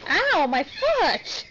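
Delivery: sudden loud ouch, drawn out; pained yelp. Real, not acted. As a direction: yelp as your foot gets hit. Foot hurt while playing with baby.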